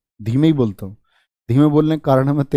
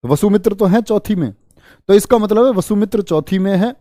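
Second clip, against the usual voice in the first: raised emphatic pitch; 7 semitones higher than usual. Real, not acted. Teacher stresses key point.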